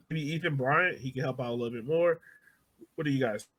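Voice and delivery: normal talking voice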